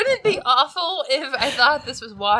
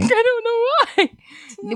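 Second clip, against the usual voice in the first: mock innocent; 10 semitones higher, slower than usual. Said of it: "echoing mock innocence".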